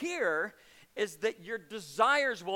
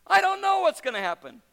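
loud, high, fervent retort; emphatic answer; 9 semitones higher, 9 dB louder than usual. Acted. Preacher rebutting the skeptic, loud high fervent retort. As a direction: fire the answer straight back.

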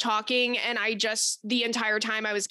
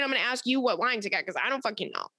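usual speaking voice

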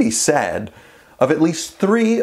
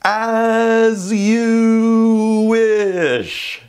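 very slow, higher, tender As you wish; Wesley's reply; 8 semitones higher, much slower than usual. Retold or imitated. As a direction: draw out As you wish like a devoted lover. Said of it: "Wesley's standard reply".

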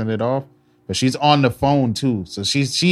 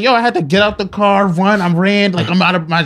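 higher, louder, imitating a panicked woman; retold frantic panic; 6 semitones higher, 4 dB louder than usual. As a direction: replay her breathless escape. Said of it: "she is out of breath".

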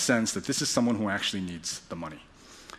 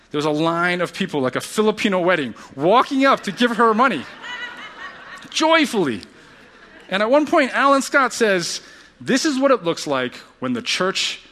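high, loud, joyful testimony building up; impassioned preaching; 8 semitones higher, 5 dB louder than usual. Real, not acted. Preacher tells congregation of generous giving, impassioned. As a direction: preach it with rising wonder.

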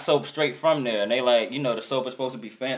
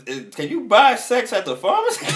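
much higher, louder disbelieving question breaking into a laugh; incredulous laugh; 10 semitones higher, 6 dB louder than usual. Retold or imitated. Asking about the dressed-up pharmacist, incredulous laughing. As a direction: ask it in amused disbelief. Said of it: "And I was like".

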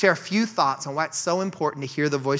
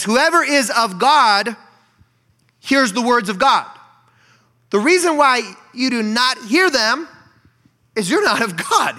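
solemn scripture reading; 6 semitones higher, 5 dB louder than usual. Acted. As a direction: read the words with gravity. Reading Jesus' words aloud.

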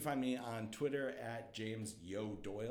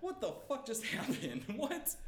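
stunned disbelief; 12 semitones higher than usual. Retold or imitated. Disbelief after the sale.

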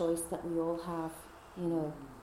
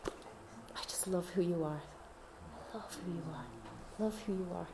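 tender whisper; 11 dB quieter than usual. Pretend. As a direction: breathe the words of love, barely voiced. Jesus' loving words in dream.

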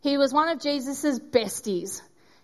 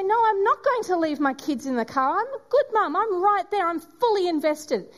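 emphatic reply; 6 semitones higher than usual. Retold or imitated. Speaker answering Jesus about her kids, high and emphatic. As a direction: declare it with full conviction.

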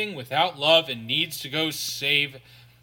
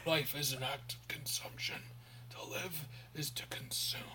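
menacing whisper; 14 dB quieter than usual. Retold or imitated. Acting out the villain's creed, in a menacing whisper. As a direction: breathe it like a sinister secret.

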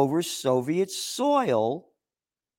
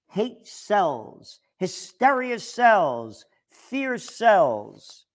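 slow drawn-out scorn; 4 semitones higher, much slower than usual. Real, not acted. Listing what sells.

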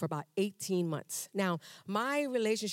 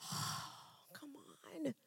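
hushed, breathy "oh come on"; a whispered groan of protest; whispered complaint; 7 semitones higher, 14 dB quieter, much slower than usual. Retold or imitated. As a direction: mutter it under your breath in exasperation. Aside to God, whispered.

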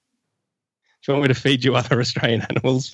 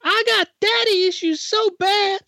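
very high falsetto. Acted. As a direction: squeak it out like a cartoon girl. Falsetto character bit.

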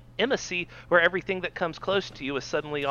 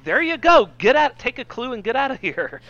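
high, loud hammed-up shooing; exasperated divine voice; mock God; 8 semitones higher, 5 dB louder than usual. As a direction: tell him to take the hint.

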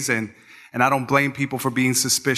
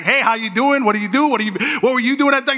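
higher-pitched, eager mock greeting; all welcoming, excited; 10 semitones higher, 3 dB louder than usual. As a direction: imitate an over-friendly driver greeting a passenger. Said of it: "he's, like, all welcoming, and he's, like, all excited".

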